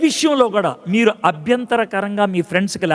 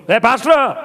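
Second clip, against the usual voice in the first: a sharp, impatient "ఏ pastor"; calling the pastor with an irritated, sharp tone; 3 semitones higher, 3 dB louder, slower than usual. Shown as a demonstration. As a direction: call him out with annoyance. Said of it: "ఇదో పిలుపు".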